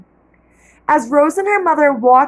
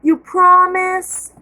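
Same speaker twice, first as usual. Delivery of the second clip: put-on hopeful child voice; 6 semitones higher, slower than usual. A narrator's reading. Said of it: "asked Rose".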